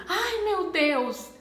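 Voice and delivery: slow cry of dismay; with intonation. Shown as a demonstration. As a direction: exclaim it in distress. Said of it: "Agora, se eu falo".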